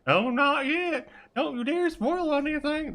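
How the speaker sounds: very high falsetto chatter; high-pitched put-on cartoonish chat; high character voice. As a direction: do every voice in the same silly high pitch. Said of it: "do every voice like this".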